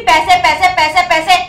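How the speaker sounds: loud, scornful parroting; mocking repetition. Customer mocks delivery woman's demands, loud scornful repetition. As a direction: hammer out the word in mockery.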